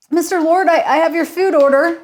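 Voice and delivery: timid, stammering chef; nervous stammer. Acted. Nervous chef announces food.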